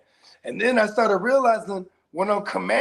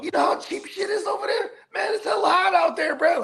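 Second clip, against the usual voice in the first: eager pitch; 9 semitones higher than usual. Retold or imitated.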